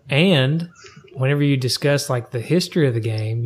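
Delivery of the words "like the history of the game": normal talking voice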